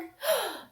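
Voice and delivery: sharp, high gasp of surprise; surprised gasp. Acted. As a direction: gasp as if shocked for the kids. Host reacts to the milk jug.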